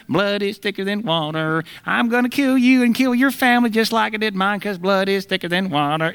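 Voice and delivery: high, strained creepy maniac voice, hammed up; shrill-free but strained crazed villain, comic; mock killer voice. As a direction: ham it up like a horror-film murderer. Mock horror-movie killer voice.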